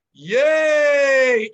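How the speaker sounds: loud, drawn-out high yay; yelled catchphrase. Retold or imitated. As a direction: imitate a comic yelling his catchphrase. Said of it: "He's yelling yay".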